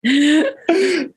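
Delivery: a high, sudden burst of laughter. Real, not acted. Guest laughs at his own cluelessness, high bright laugh. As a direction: laugh out loud at yourself.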